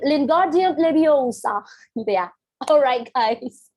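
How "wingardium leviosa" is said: slow, playful spell casting; magic spell. Pretend. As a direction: cast it like a magic spell, drawn out. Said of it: "saya tahu tuh ada accent ya". (merapal mantra sihir dengan pelan)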